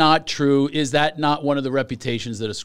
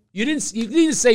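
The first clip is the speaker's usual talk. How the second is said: ordinary talk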